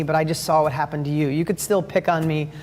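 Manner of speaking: ordinary talk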